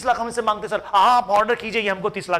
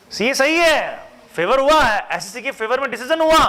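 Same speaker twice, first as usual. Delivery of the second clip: triumphant verdict; 6 semitones higher, 3 dB louder than usual. Acted. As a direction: announce the win grandly. Announcing verdict for the taxpayer. (जीत का ऐलान, ऊँचे स्वर में)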